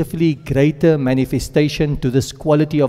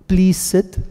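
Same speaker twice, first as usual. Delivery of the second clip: courteous, higher-pitched demonstration; gently request it, pitched up; polite request; 4 semitones higher than usual. Shown as a demonstration. Teacher repeating the nicer phrase for students, politely.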